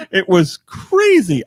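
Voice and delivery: laughing, slow and high. Real, not acted. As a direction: laugh through the words. Laughing after the win.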